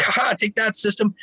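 gloating, high sing-song jeer; mock taunt. Mock taunt at rival band.

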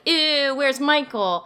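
high, drawn-out grossed-out whine; mock fan complaint. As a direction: imitate disgusted fans missing their favorite. Mimics disappointed fans' complaint.

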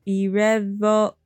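ordinary delivery

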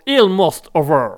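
slow, hammed-up English accent on Almost Over. Shown as a demonstration. Radio host showing off his English to listeners, slow mock English accent. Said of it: "De mieux en mieux ton anglais".

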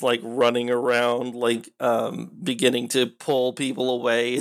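usual speaking voice

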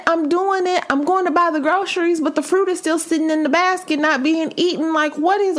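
high frustrated rant; frustrated whine. Retold or imitated. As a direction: wail it up high like you're fed up. Retelling frustration, pitch way up.

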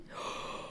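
sharp frightened gasp; fearful gasp. Retold or imitated. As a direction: gasp like something scared you. Gasp imitating scary nun.

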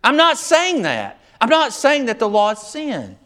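high, insistent protest with "not" punched; emphatic denial. Acted. Preacher voicing Paul denying the law is sin, emphatic. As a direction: push back hard, pitch leaping on "not". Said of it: "he's very emphatic".

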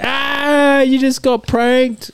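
high, slow gloating prank reveal; prankster. Retold or imitated. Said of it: "Just like".

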